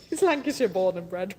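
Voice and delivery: amused, through laughter; laughing. Real, not acted. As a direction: laugh while putting the joke right. Laughing at own accent slip.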